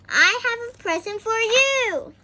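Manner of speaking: high, loud happy news; cheerful caller; excited calling. A narrator's reading. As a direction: announce the gift with delight. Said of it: "called out".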